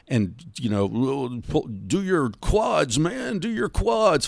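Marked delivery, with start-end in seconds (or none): 1.5-4.3 slow, much higher barking trainer parody; mock gym trainer. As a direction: ham up a pushy gym coach.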